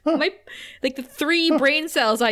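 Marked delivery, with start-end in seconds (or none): none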